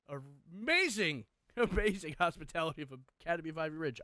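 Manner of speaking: dripping irony about the program; a host sarcastically praising the hellish academy; sarcastic praise. Real, not acted. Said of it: "I'm kidding".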